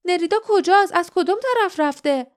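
high-pitched, pressing questions; urgent demand. A narrator's reading. Jasmine asking guardian where Nerida went, urgent.

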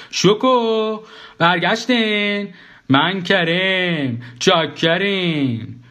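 high, long drawn-out call. A narrator's reading. Karim hollers from the kitchen as they arrive, calling out. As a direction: stretch each word out like a singsong holler. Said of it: "صدای داد کریم اومد".

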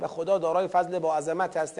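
ordinary talk

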